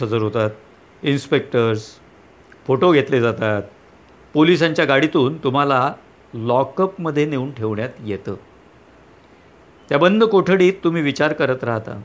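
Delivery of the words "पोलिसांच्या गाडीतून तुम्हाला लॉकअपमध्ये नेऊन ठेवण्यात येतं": ordinary delivery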